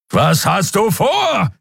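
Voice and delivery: slow, high shout. A narrator's reading. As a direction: yell the question over the noise. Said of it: "brüllte Kero wieder". (gebrüllt, langsam und hoch)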